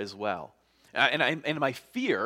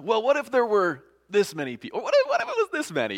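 high, loud, hurried haggling; acted pushy negotiating, pitch climbing; bargaining; 10 semitones higher, 7 dB louder, faster than usual. Acts Abraham bargaining with God.

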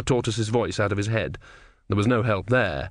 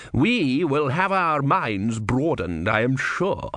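menacing inquisitor; 3 semitones higher, much slower than usual. Acted. Vorbis slow, smug.

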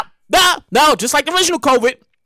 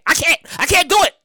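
ordinary talk, nothing marked

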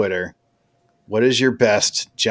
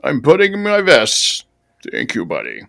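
loud, higher put-on host voice; Quakes impression; 4 semitones higher, 5 dB louder than usual. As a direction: thank the guest in a hammed-up Quakes voice. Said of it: "You gotta do this in quakes".

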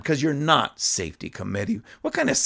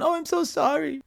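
squeaky mocking falsetto, much higher; mocking whiny apology; 12 semitones higher, 3 dB quieter than usual. Host mocking a politician's apology in a falsetto whine. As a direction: whine it like a fake-sorry coward. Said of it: "one of those cowards going".

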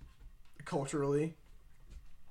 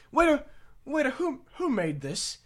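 put-on higher calling for the waiter in a posh British accent; 12 semitones higher than usual. Posh British diner calling the waiter, British accent. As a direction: summon the waiter, British and grand. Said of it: "he's got a British accent".

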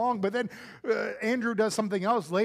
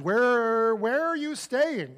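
halting, higher and slower; stammering; 5 semitones higher, 5 dB louder, much slower than usual. Retold or imitated. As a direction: trip over the words, nervous. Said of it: "what they stammer out is".

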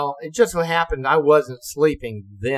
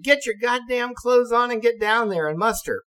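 higher, impatient order; barked order; 9 semitones higher than usual. Retold or imitated. Officers ordering ensign to muster, impatient. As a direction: hurry him up, fed up.

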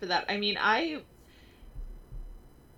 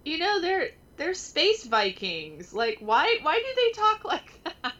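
real giggling words, pitched much higher; high laughing voice breaking through the words; laughing while talking; 11 semitones higher than usual. Real, not acted. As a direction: talk through the giggles at how silly it is. Laughing at comic Thor's speech.

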